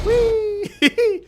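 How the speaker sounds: high, loud squeal of glee breaking into laughter; squealed whee with a giggle; delighted whee. Real, not acted. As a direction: let out a gleeful, high-pitched "wheee". Delighted whee, then laughs at falling box.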